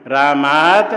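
रामात called out loudly, rising at the end; loud model. Shown as a demonstration. Teacher chiding the lazy row, loud model. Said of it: "ये line आलसी है".

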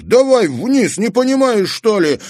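put-on high, loud impatient boy; angry urging. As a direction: push him to descend, annoyed. Dik urging angrily. (сердитый окрик Дика)

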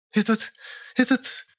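very high sputtering female voice; stammer, lost for an insult; choking with indignation. A narrator's reading. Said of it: "задохнулась от возмущения". (задыхаясь от возмущения, высоким голосом)

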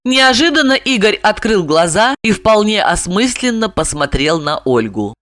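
plain reading voice

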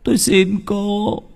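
high, pleading murmured prayer; fearful prayer. A narrator's reading. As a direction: pray to the ghost, begging. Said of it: "nó lầm rầm khấn".

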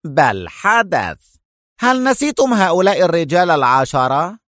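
severe, rebuking retort; stern. A narrator's reading. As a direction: insist firmly, stretching the first words. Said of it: "أجابها المفتش في صرامة".

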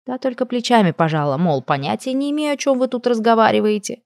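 plain reading voice

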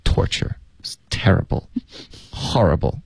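slow, hushed, voiceless words of suffering; mock-tormented whisper. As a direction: whisper each word slowly, as if in torment. Dramatizes daily torment in whisper.

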